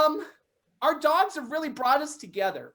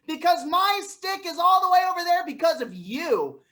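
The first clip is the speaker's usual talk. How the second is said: normal talking voice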